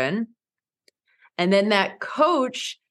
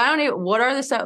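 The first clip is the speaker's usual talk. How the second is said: flustered rush; 5 semitones higher, much faster than usual. Retold or imitated. Retold flustered confusion.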